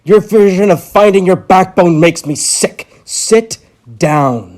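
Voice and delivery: loud, disgusted order; furious doctor ordering the patient to sit; angry command. Acted. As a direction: bark him back into his seat.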